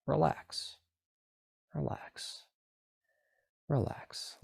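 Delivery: fading to a whisper; whispered relax. Shown as a demonstration. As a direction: breathe the word relax softly away. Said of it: "continue to tell your body".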